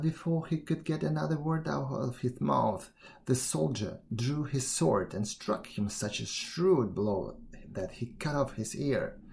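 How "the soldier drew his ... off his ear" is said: usual speaking voice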